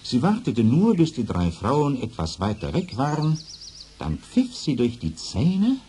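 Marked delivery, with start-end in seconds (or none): none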